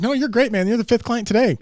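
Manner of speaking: loud cheery reassurance. Retold or imitated. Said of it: "I was like".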